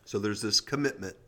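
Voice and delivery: normal talking voice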